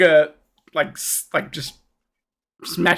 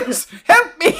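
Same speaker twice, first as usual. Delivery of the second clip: high, hammed-up cry for rescue; calling for help; 11 semitones higher than usual. Caged merchant calling the goblins, high plea for help. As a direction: call out high to the guards.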